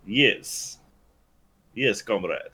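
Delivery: deadpan Russian comrade voice; Russian accent. Pretend. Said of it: "I even do the voice".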